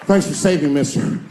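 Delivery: loud, bright call of a grateful passerby; grateful citizens. Retold or imitated. Citizen shouting thanks up to the flying hero, loud and eager.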